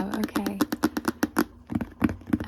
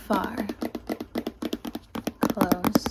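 usual speaking voice

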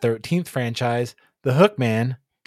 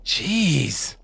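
exasperated, higher-pitched groan of a word; disgusted; 4 semitones higher than usual. Acted. Boyfriend giving in to his girlfriend, disgusted. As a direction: let it out in disgust. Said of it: "her boyfriend said in disgust".

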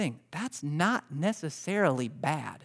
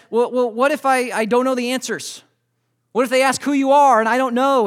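raised, loud, flustered questioning; anxious objection; 8 semitones higher, 8 dB louder than usual. Acted. Moses worries to God about having no answers, anxious. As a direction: act out worried stammering doubt, loud and high. Acts out Moses worrying aloud.

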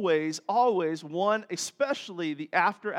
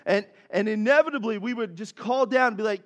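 ordinary talk

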